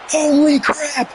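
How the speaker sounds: slow, high, amazed exclamation; stunned disbelief. Real, not acted. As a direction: let the shock draw the words out. Stunned by the last-second win.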